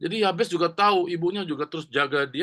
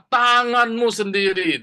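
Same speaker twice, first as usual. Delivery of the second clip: fervent plea; 7 semitones higher, 7 dB louder, slower than usual. Acted. Jabez praying to God.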